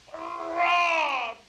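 long, very high outraged shout; maddened crowd's cry. A narrator's reading. Maddened thousands protesting the umpire's call, high drawn-out cry. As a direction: cry out like a furious mob. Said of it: "cried the maddened thousands".

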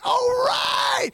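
high, drawn-out cry of delight; re-enacted squeal of triumph; elated. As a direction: whoop it like you just got in. Retold joy at guest list yes.

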